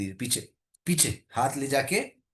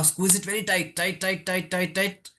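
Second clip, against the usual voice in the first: higher-pitched, insistent repeated cue; urging coach; 5 semitones higher, slower than usual. Real, not acted. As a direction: press them to tighten with each repeated word. Coach urging a muscle squeeze.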